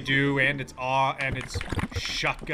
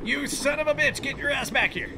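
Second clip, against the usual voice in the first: high-pitched, indignant outburst; riled-up taunting; 10 semitones higher than usual. Real, not acted. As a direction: yell after an enemy that's getting away. Yelling at a fleeing enemy.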